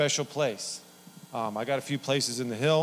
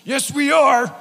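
much higher and louder, put-on buddy voice; insistent friend; 12 semitones higher, 10 dB louder, slower than usual. Lance overruling his reluctant friend, high and loud. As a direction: cheerfully overrule him.

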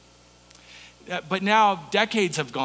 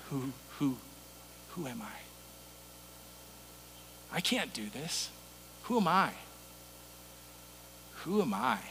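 much softer, halting mock stutter; stuttering Moses; 6 semitones lower, 16 dB quieter than usual. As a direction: stumble over the words like a scared Moses, very soft. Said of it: "with a stutter".

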